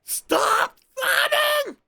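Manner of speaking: slow, very high yell; loud high shout. Acted. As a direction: bellow it slowly from high up. Shouts at a sobbing game character.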